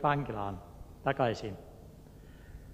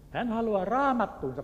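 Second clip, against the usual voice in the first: raised, higher call of the word raamattuunsa; boys calling out; 8 semitones higher than usual. Retold or imitated. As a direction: call out "raamattuunsa" eagerly to the pastor. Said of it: "pojat huusivat".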